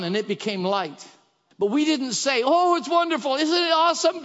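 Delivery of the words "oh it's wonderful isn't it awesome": mock gushing. Mocking gushing churchgoers.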